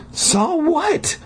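very high acted exclamation; crying out. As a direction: squeal the question in mock alarm. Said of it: "I cried".

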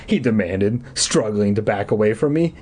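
ordinary delivery, nothing marked

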